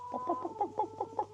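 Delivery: high clucking like a hen; chicken clucking. Real, not acted.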